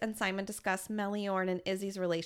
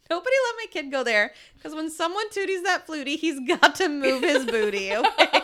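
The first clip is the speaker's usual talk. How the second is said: loud, fast, hammed-up cartoon donkey voice; rowdy cartoon sidekick; Donkey from Shrek; 4 semitones higher, 8 dB louder than usual. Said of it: "Did you just quote Shrek?".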